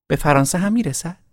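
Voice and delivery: put-on high questioning girl; wondering girl. A narrator's reading. Girl asking if his realm reaches France. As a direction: ask it wide-eyed.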